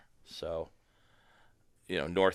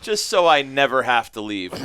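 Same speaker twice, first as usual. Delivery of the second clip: ordinary talk, nothing marked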